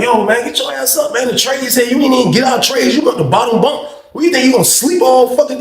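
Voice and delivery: scolding lazy cellmate over food trays; annoyed scolding. Retold or imitated. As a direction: get him out of bed. Telling cellmate off.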